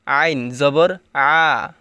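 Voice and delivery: আইন জবর আ pressed from mid-throat, slow; throaty ain. Shown as a demonstration. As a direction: squeeze আ in the throat. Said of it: "গলার এখানে একটু চাপ দিতে হবে।".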